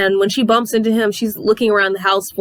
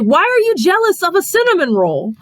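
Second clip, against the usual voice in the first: mocking son; 11 semitones higher, 3 dB louder than usual. He mocks mother's jealousy.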